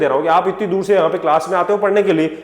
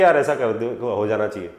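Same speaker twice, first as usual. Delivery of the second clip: mild request; 7 semitones lower than usual. Acted. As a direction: put it as an easygoing suggestion. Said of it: "वो नहीं बोलेंगे कि".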